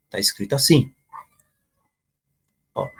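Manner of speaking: usual speaking voice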